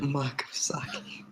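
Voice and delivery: whispered, drawn-out echo of a drink order. Pretend. Echoing a friend's mug of sake, whispered. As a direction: whisper it slowly, very softly. Echoes another player's drink line.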